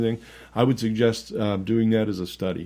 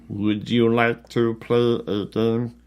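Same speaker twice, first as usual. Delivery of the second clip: slow, drawn-out horror villain voice; menacing puppet voice from a horror film; slow creepy Jigsaw voice; much slower than usual. Pretend. Saw movie quote voice.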